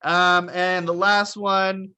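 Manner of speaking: usual speaking voice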